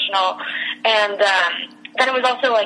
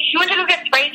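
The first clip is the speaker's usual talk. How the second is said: retold disbelief, pitched much higher; incredulous echo; 9 semitones higher than usual. Friend hears of Olivia's Sprite errand, incredulous. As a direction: repeat it back in high-pitched disbelief. Teller reacts to friend's long absence.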